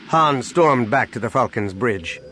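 ordinary delivery

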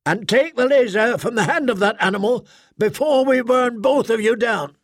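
high, imperious threat; threatening squad mother. Acted. Squad mother ordering Arik to disarm the speaker, threatening.

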